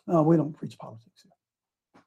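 low, soft, dismissive pastor voice; a lightly voiced, brushing-off pastor; quiet condescending preacher; mock pastor. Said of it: "He would say".

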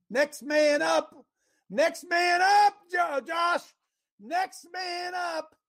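building from firm to a shout; a rallying shout that builds; rousing call to action. Acted. God calling Joshua up, building.

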